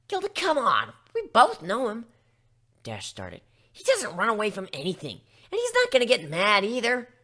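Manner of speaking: a brash pony loudly reassuring her friend; firm reassurance. Acted. Dash reassuring Gilda firmly.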